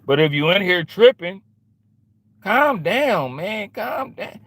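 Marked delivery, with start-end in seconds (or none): 2.4-4.4 mock soothing. Telling trolls to calm down.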